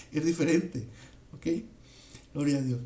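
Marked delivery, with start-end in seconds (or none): none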